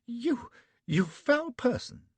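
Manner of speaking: slow, high, loud indignant lady; indignation. A narrator's reading. Betty condemns Flashman, slow and indignant. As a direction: drag out each word in disgust. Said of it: "says she in indignation".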